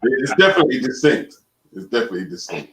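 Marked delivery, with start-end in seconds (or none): 1.7-2.7 softer, lower repeat to himself; quiet murmured echo of the same words. Real, not acted. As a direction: mutter it again under your breath. Echoing himself under his breath.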